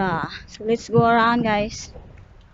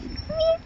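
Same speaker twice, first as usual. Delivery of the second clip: very high soft meow; cat call meow; imitated meow; 5 dB quieter than usual. Real, not acted. Meowing at the cat.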